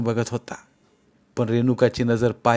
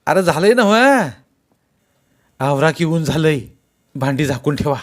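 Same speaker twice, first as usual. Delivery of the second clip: raised, louder questioning call, easing off; prodding cook; 4 semitones higher, 5 dB louder than usual. A narrator's reading. One cook asks the others if it's done, prodding call. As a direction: hurry the other cooks along. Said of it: "बाकीच्या स्वयंपाक्यांकडे बघत एकानं विचारलं".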